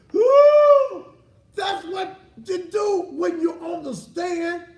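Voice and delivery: high, loud outraged shouting; indignant mocking rant. Real, not acted. Comedian ranting about the defendant's tears, loud and high. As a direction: shout it out in disbelief. Mocking her crying on the stand.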